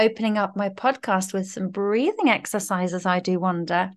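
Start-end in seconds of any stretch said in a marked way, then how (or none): none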